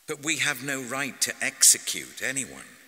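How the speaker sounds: high, loud protest; objecting. A narrator's reading. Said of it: "they objected".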